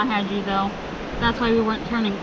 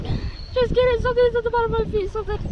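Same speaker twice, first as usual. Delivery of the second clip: panicked; 12 semitones higher, 5 dB louder than usual. Retold or imitated. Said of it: "I was like".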